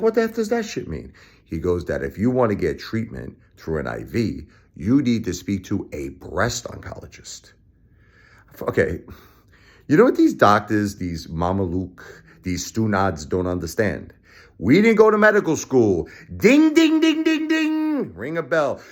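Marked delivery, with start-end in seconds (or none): 0.0-1.1 high, shocked outburst; incredulous squeal. Retold or imitated. Retelling his reply to the oncologist, high disbelief. 14.6-16.1 high, loud exasperated outburst; angry rant. Real, not acted. To doctors who failed his wife, high loud rant. 16.4-18.1 high, sing-song ding ding; mock bell dings. Mocking the doctors, high bell dings.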